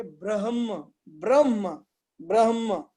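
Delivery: ब्रह्म with h before m, "brah-ma"; model pronunciation. Shown as a demonstration. Teacher joins half h and m, "brah-ma". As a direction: breathe the h, then close on m in ब्रह्म. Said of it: "ऐसा बोलना है".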